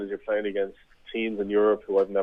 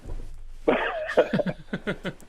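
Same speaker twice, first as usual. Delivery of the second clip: breathy laugh; 12 semitones higher, 4 dB quieter than usual. Real, not acted. Laughing at the joke.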